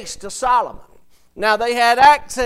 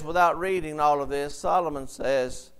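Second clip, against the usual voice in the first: usual speaking voice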